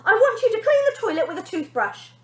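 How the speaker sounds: very high, loud demand; bossy girl demanding; bossy command. Acted. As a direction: boss her around. Bonnie bossing her mother.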